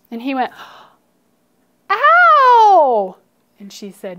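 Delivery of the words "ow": high, loud "ow!" of pain; long wail of a stomped toe; acted pain yelp. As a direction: yell out in pain, high and drawn out. U's toe gets stomped, acted.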